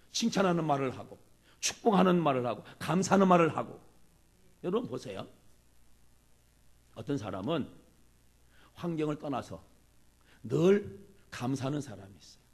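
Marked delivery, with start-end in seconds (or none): none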